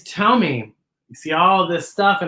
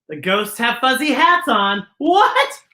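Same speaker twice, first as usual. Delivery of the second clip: plain reading voice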